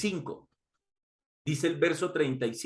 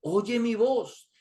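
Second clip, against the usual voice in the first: slow and higher, lightly voiced Jesus; gentle appeal; 4 semitones higher, slower than usual. Jesus appeals to Pilato, slow and lightly higher. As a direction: linger on it as a gentle plea.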